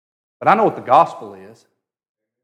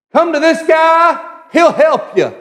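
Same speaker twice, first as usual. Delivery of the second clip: loud, high, drawn-out call; 7 dB louder, slower than usual. Acted. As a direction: holler to a crowd to come over. Acts people calling the sick over.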